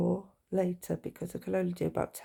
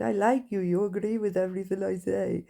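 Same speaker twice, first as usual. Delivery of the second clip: psychologist impression; 2 semitones higher than usual. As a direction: mimic the assessor's odd way of talking. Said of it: "That was what his voice like".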